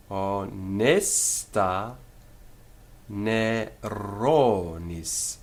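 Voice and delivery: drawn-out Latin verse, syllable by syllable; very slow measured reading, every long vowel held; extremely slow read-through. Shown as a demonstration. Teacher reads Latin verse through for students, extremely slowly. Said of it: "extremely slow".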